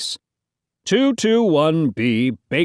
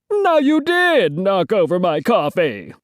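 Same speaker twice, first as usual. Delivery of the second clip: higher-pitched, exasperated outcry; crying out in complaint; 7 semitones higher than usual. Acted. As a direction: cry out in protest. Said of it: "cried Hotshot Terbletsky".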